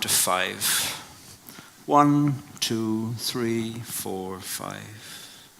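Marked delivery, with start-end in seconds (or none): none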